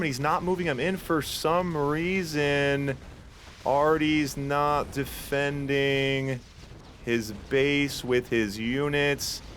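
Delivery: very slow, stretched words sinking in pitch; drawn-out dismay. Real, not acted. Caster watches Artie fail to defend his base, drawn-out dismay. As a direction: draw each word out as defeat sinks in.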